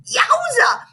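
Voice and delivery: high yelp of pain; a high yowza. A narrator's reading. Kids hit by drumstick.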